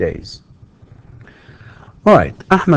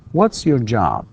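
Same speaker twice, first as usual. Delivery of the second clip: "What's" on top, falling to "job"; falling intonation. Shown as a demonstration. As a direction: let "What's your job" slide downward. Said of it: "it's falling intonation".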